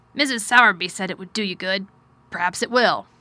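firm but mildly distracted guardian; troubled guardian. A narrator's reading. Guardian considering his ward's wishes, lightly firm. Narrator voices Mister Craven.

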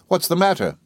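put-on high, alarmed child's question; mild panic, pitched high; frightened. A narrator's reading. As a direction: ask like a scared boy. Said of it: "said I, quite frightened".

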